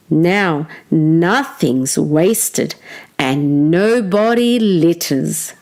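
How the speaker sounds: ordinary delivery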